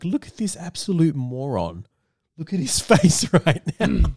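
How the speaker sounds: mock her disdain with a laugh; scornful aside. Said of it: "Zendaya's looking at Tom Holland like".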